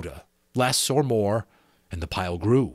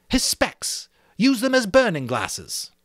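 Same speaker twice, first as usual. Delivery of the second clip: sudden excited idea; 12 semitones higher, 4 dB louder than usual. A narrator's reading.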